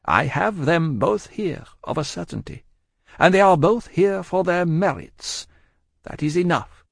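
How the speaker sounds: high-pitched, unyielding Madame; Madame, composed and resolute. A narrator's reading. Madame answers her pleading husband, high and resolute.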